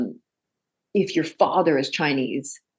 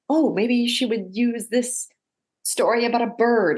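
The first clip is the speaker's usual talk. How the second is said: high, slow, hammed-up naive musing; mocking writers; 7 semitones higher than usual. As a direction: parody clueless writers pondering. Mocking writers Googling proverbs.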